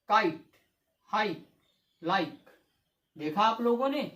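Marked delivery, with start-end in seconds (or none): 0.1-2.4 "काइट, हाई, लाइक" each one syllable, no final E; silent E. Shown as a demonstration. Teacher reading examples of the rule, silent E. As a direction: end "काइट" and "लाइक" on the consonant. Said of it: "ये silent होता है".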